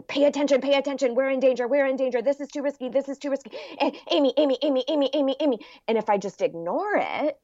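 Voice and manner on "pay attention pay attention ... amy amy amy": high, urgent, insistent alarm voice; anxious little inner voice. Retold or imitated. Inner voice nagging Amy about danger, high urgent repeats. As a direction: keep calling her name like a nagging child. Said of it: "that little voice that's going".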